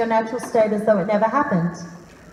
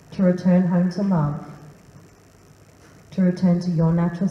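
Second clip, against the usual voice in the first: guided meditation; 4 semitones lower than usual. Acted.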